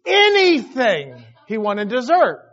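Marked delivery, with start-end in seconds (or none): 0.1-1.2 loud, slow, raised plea; mock earnest begging; imitated pleading son. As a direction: draw it out like a kid bargaining with dad. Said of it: "Isaac said that very words".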